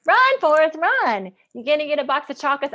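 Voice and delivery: high, loud call-out, easing off on the second line; mock shout of a movie catchphrase; Forrest Gump catchphrase heckle. People teasing her about her name, mock heckling call. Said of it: "all I heard was".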